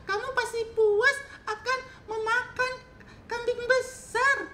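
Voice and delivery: very high, loud squeaky goat; pleading medium goat. Acted. Middle goat begs the giant not to eat it, high loud squeak. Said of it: "kata si kambing sedang kepada raksasa".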